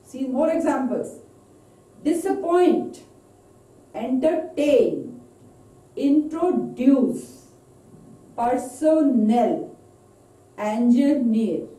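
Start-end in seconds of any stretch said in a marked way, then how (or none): 2.0-11.7 "Disappoint" to "engineer" in three slow beats, landing on the third; stress on third syllable. Shown as a demonstration. Teacher showing more examples, "Disappoint" to "engineer" stressed on third syllable. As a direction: break up "Disappoint, entertain, introduce, personnel, engineer", stressing the last syllable. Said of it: "stress on the third syllable".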